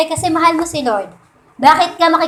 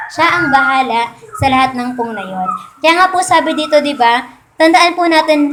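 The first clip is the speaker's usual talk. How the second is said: normal talking voice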